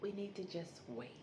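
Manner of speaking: gentle steady voice of a patient wife; calm reassurance. Retold or imitated. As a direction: quietly settle him down. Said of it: "she's so calm too".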